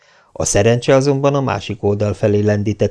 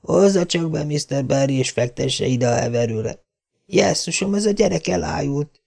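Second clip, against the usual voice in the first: soft, higher urgent voice; Marilla alarmed; 6 semitones higher, 4 dB quieter than usual. A narrator's reading. Marilla asking Mr. Barry to carry Anne in, alarmed. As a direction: give orders in alarm.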